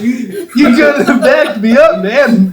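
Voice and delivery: high, slow laughing complaint. Real, not acted. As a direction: laugh while you blame him. Teasing friend, laughing.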